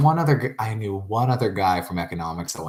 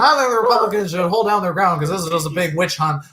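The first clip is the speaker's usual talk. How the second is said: higher, louder mock partisan voice; mock political ranter; 8 semitones higher, 4 dB louder than usual. Podcaster parodying people's clashing political opinions, mock ranting. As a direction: imitate opinionated voters sounding off.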